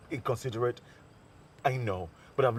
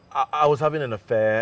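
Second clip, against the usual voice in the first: louder blurted admission; blurted confession; 2 semitones higher, 9 dB louder than usual. Acted. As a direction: force the confession out.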